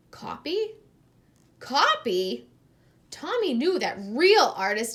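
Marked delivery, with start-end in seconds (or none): 0.1-2.5 high incredulous double question; shocked disbelief. A narrator's reading. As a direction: echo it in disbelief. Tommy shocked at copying.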